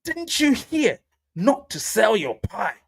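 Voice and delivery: exasperated scolding. Pretend. Scolding panicked sellers, high and slow. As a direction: drag it out like scolding a child. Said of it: "hold their ears and pull it and be like".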